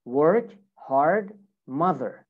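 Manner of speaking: American "work, hard, mother" with every R sounded; American accent. Shown as a demonstration. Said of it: "American English".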